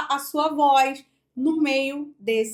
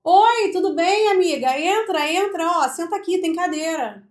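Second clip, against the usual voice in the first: higher, louder, thrown toward someone; projected voice; 4 semitones higher, 5 dB louder than usual. Shown as a demonstration. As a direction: greet a friend with projected voice. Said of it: "Agora eu vou projetar a minha voz".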